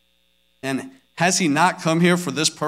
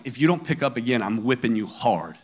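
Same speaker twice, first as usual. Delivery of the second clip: retold angry threat; 6 semitones lower, 6 dB quieter than usual. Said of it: "then I start to get angry".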